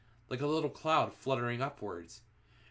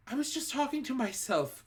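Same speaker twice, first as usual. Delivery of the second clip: much higher frightened witch voice; terrified pleading; 14 semitones higher than usual. A narrator's reading. Front-row witch excusing herself, much higher terrified plea. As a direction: plead for your life. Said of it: "cried the wretched".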